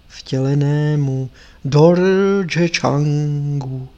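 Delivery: very slow, drawn-out incantation; solemn dedication. Acted. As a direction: intone each word like a sacred invocation. Recites solemn verse dedication.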